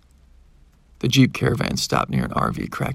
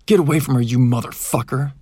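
angry confrontation; 2 semitones higher, 6 dB louder than usual. A narrator's reading. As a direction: burst in shouting to warn the attacker off. Rescuer bursts in on the attacker.

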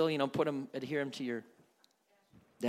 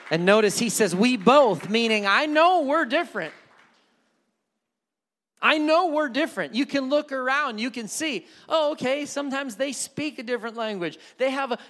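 preacher rising in pitch and volume; preaching; 9 semitones higher, 9 dB louder than usual. Acted. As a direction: lift it up and preach to the room. Sermon rising to full force.

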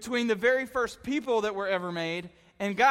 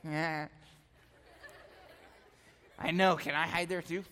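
put-on lower, softer kid brother voice; imitating little brother; 4 semitones lower, 6 dB quieter than usual. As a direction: play the clueless little brother. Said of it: "My brother still talks like this".

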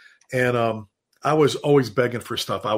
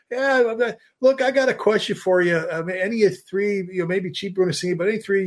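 higher, eager caller asking for help; a lieutenant calling the chiefs for advice, in a pitched-up eager voice; mock caller voice; 5 semitones higher than usual. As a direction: pitch it up like a keen young lieutenant. Caller voiced in retold story.

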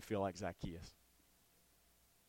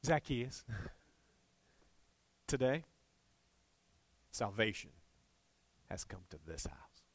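Jesus gently telling Zacchaeus salvation came, tenderly. Retold or imitated. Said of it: "he probably did this".

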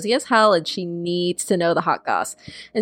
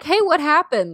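chirpy mock hey; 6 semitones higher than usual. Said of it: "she's just like".

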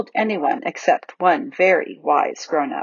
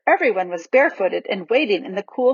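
usual speaking voice

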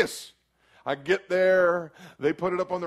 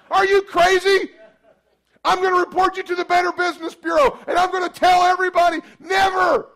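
much higher, much louder angry yelling; furious shout; 10 dB louder than usual. Retold or imitated. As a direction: blow up and yell at the shop. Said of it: "I still wanted to go".

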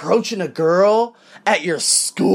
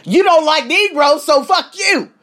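louder and higher, spitting it out; angry retort; 9 semitones higher, 5 dB louder than usual. Retold or imitated. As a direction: snap back at her loud. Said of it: "I'm like".

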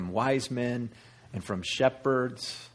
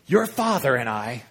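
louder, pointed mother's reproach; in-your-face scolding; 5 dB louder than usual. Acted. Mother confronting her son, in-your-face scolding. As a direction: get right in the child's face. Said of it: "She's getting into Jesus' face".

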